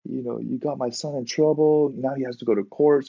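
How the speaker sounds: high, held-back mock scolding; mad dad. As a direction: imitate an angry father at reduced volume. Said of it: "got so mad at him".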